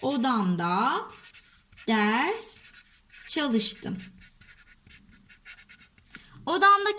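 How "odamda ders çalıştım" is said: slow, each word followed by a pause; dictating while writing. Shown as a demonstration. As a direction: say each word slowly as you write it. Yazalım cümlemizi.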